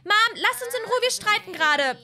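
high, loud snapping at mom; yelling at mom. Acted. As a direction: shout her away. Daughter yells at mom.

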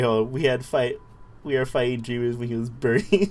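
ordinary talk, nothing marked

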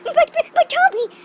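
very high, loud, slow mock protest; pet's retold voice. Toby imitating the pet he picked up, squeaky mock protest. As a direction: squeak it slowly as the put-upon pet. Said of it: "And he was like".

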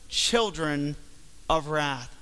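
ordinary talk

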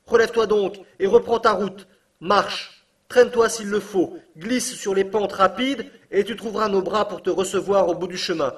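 loud, high call carried through rock; calling from afar. A narrator's reading. Uncle calls to Axel through the rock, loud and high. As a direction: call out to a lost boy far below.

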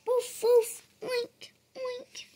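high woofs then squealy oinks; bark, then squeal like a piglet; puppy and piglet noises. Acted. Boy doing Biscuit and the piglet. Child does animal noises.

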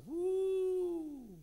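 long, high "woo" falling at the end; sobered whoop. Retold or imitated. Man just rebuked by God, long high woo. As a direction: let out one loud, sustained woo.

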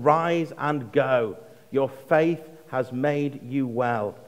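slow, measured words of Jesus; slow, solemn blessing; slow solemn quote. Retold or imitated. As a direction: lay each word down slowly.

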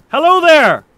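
loud, high call to a stranger. Real, not acted. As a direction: hail him and make him stop. Calling to a stranger in game.

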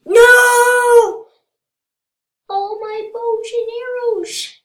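very high, loud cry of loss; dismayed wail. Real, not acted. Player loses bows and arrows, dismayed wail. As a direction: wail loudly at losing everything.

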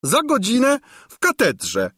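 put-on high, fainting old woman's sigh; swooning sigh. A narrator's reading. Old governess sighs dreamily at the king, high and swooning. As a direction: sigh it out like a lovesick old crone. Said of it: "westchnęła Gburja Furia omdlewającym głosem".

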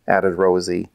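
ordinary delivery

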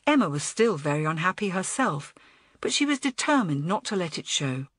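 ordinary delivery, nothing marked

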